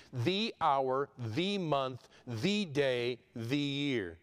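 "the" stressed before each noun, evenly spaced. Shown as a demonstration. Preacher showing what the Greek text doesn't say, stressing each "the". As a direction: set off each item with a clearly stressed "the".

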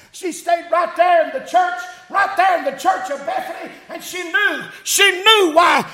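high, driving country-church preacher; full-force preaching. Real, not acted. Preacher proclaiming the risen Christ to his congregation, fervent preaching. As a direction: preach it out, pitch held high.

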